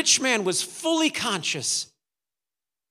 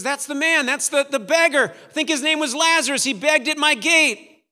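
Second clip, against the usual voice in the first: raised, louder cry of recognition; startled recognition; 7 semitones higher, 7 dB louder than usual. Acted. Rich man in hell spotting the beggar at Abraham's side, startled cry. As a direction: call it out high and insistent.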